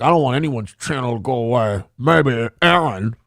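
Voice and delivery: lightly voiced mumbling mock of a streamer; mocking the panhandler. Streamer mocks a rival's quote, lightly voiced mumble. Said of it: "He's like".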